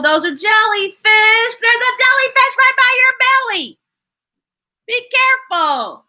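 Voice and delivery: pretend-scared, rising warning; playful alarm. Reading to a child, playfully warning about a jellyfish.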